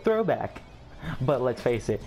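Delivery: usual speaking voice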